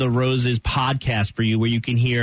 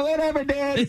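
stoner voice. Retold or imitated. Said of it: "other guys are like".

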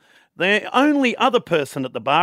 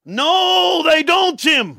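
shouting; 10 semitones higher, much slower than usual. Acted. Punchline shout of joke.

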